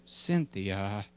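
low, soft fatherly name call; cartoon dad interrupting. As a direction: cut in as the subdued daddy. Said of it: "I'll read the cartoon to you".

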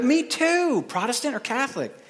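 high, thrilled discovery; acted excitement, voice soaring; delighted. Joke: delighted at shared faith.

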